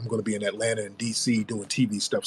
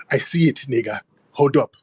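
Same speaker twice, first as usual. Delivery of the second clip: ordinary talk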